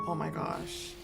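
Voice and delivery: ordinary talk, nothing marked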